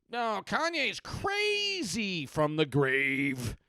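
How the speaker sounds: high, loud, long-drawn wailing words; hammy ghostly voice. Acted. Dead person tweeting from the grave, hammed-up spooky wail. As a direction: stretch it out like a cartoon ghost.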